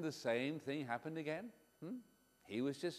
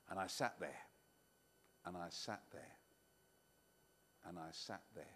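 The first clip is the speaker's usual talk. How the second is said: each repeat lower and softer, fading away; fading boredom; 11 semitones lower, 13 dB quieter, faster than usual. Acted. Bored boy sitting with the sheep, fading out. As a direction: let the words sink lower and quieter into boredom. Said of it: "repeated boredom, fading".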